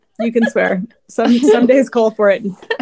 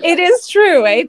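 put-on playful Canadian accent, high and bright; 9 semitones higher, 3 dB louder, slower than usual. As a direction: toss off a jokey Canadian "eh". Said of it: "The Canadian accent blends with the Minnesotan one".

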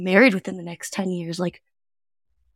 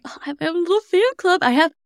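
high, giddy mock celebrity voice; mock diva; 9 semitones higher, 3 dB louder than usual. Boasting about her little fan club, high giddy mock diva. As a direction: gush like a star with a fan club. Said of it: "It's like".

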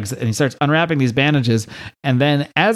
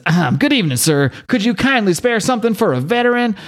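higher, put-on polite begging voice; extra-nice panhandler; 9 semitones higher than usual. Panhandler repeats his pitch for ten bucks, extra nice. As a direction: lift the pitch and beg ever so nicely. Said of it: "if you do it real nice".

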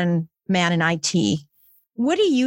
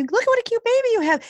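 put-on much higher, quick gushing baby-admiring voice; a gushing visitor praising a cute baby; cooing admirer; 14 semitones higher, much faster than usual. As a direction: coo over someone's newborn.

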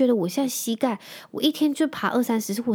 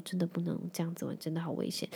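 much softer, a grave hushed warning; quiet, heartfelt caution, softer and a bit lower; earnest and soft; 3 semitones lower, 13 dB quieter than usual. Retold or imitated. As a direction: lower the voice like a shaken dad speaking from the heart. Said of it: "语重心长跟我讲说".

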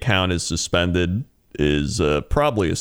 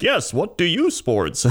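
high, slow robotic bot; lightly voiced chatbot; 10 semitones higher, slower than usual. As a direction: insist like a broken chatbot. Imitating chatbot.

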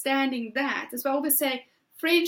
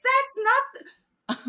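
ordinary talk

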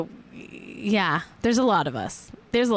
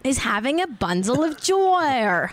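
attempted Australian accent; 3 semitones higher than usual. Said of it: "I used to be so good at Australian".

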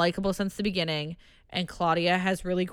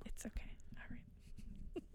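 whispering; 3 semitones higher, 22 dB quieter, slower than usual. Real, not acted. Whispered reply.

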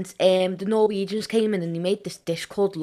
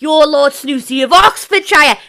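hammed-up posh Scouse shout; snobby Scouse; 7 semitones higher, 12 dB louder than usual. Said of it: "snobby Scouse".

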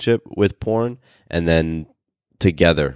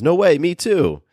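excited; 11 semitones higher, slower than usual. Retold or imitated. Reading the wife's excited reply.

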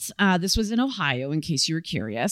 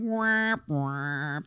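mock sad-trombone womps, second one lower; 3 semitones lower than usual. Co-host deflating the holiday cheer, sad trombone. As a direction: droop it like a deflating horn.

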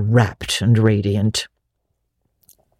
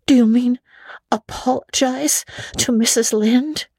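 put-on higher girlish voice, wistful; a lonely girl making the best of it; young Anne; 10 semitones higher than usual. A narrator's reading. Anne's voice.

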